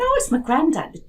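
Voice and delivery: high, sudden blurt. Retold or imitated. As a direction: blurt the answer without thinking. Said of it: "just like that".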